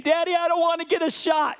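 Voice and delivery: put-on high, fast, whining child begging his dad; whiny scared kid. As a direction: plead like a small boy afraid of needles. Son scared, name called.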